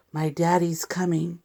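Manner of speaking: whispered with excitement. Pretend. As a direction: whisper it like a secret. Secret excited line in story.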